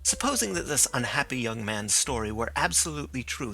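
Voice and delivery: usual speaking voice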